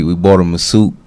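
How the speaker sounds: usual speaking voice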